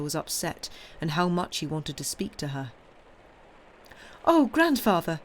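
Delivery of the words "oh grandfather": high, loud cry of concern; worried granddaughter's raised-voice exclamation; exclaiming. A narrator's reading. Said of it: "she exclaimed".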